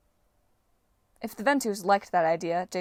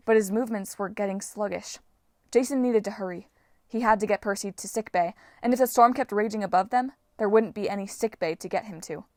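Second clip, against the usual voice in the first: ordinary delivery, nothing marked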